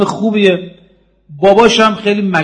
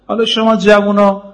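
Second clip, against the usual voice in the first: ordinary talk, nothing marked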